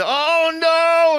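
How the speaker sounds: high terrified yelp; panicked scream. Pretend. Soldier sees robots coming.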